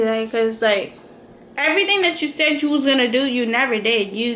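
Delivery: ordinary talk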